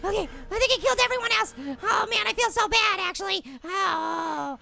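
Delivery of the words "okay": soft, low self-soothing; calming himself. Real, not acted. Player settling after a scare, calming himself. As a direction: say it under the breath to steady the nerves.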